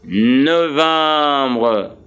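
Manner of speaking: November stretched out as French no-vahm-bruh; French pronunciation. Shown as a demonstration. Teacher sums up the spelling, November in slow French pronunciation. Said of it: "what is November in English".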